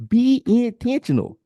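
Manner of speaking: high, loud preacher-style insistence; rising to a passionate near-shout; emphatic shout. Real, not acted. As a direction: hammer the advice home at full voice. Third repeat, building to a shout.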